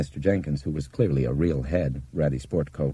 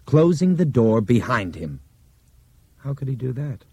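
usual speaking voice

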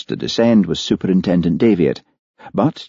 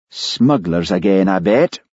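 plain reading voice

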